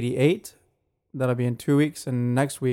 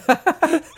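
high laugh; 5 dB louder than usual. Real, not acted. Laughing mid-sentence.

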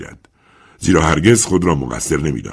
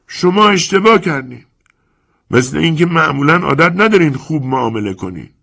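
put-on a calm, sly old lender talking down to the young man; old moneylender Chiarenza; 12 semitones higher, 5 dB louder than usual. Chiarenza hiding anxiety.

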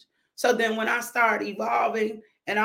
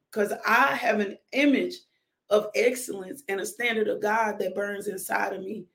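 normal talking voice

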